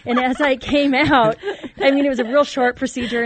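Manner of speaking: can barely get it out for laughing; giggly, loud retelling; laughing while telling. Real, not acted. Laughing recalling happy drugged state.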